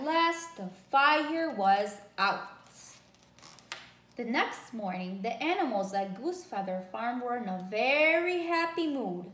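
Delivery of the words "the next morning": usual speaking voice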